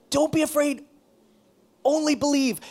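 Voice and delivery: high, loud proclaiming; preaching emphasis. Real, not acted. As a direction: proclaim it with conviction. Preaching with rising force.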